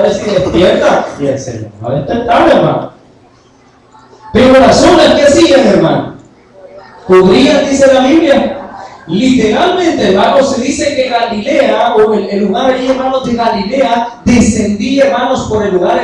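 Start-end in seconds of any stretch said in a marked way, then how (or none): 4.3-16.0 loud, high, rousing sermon voice; raised voice, driving the point home; fervent preaching. Acted. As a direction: preach it out to the congregation.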